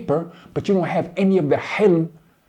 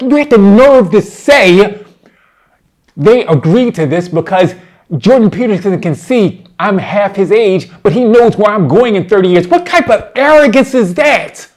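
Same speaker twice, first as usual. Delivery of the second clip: loud, high indignation; heated, incredulous tirade at full volume; outraged rebuke; 3 semitones higher, 9 dB louder than usual. Real, not acted. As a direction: raise the voice in real outrage, building to the final question. Angrily rebukes the opponent's arrogance.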